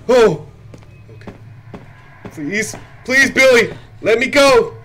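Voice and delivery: loud, high desperate pleas; panicked pleading. Real, not acted. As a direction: beg in panic. Caught by monster Billy.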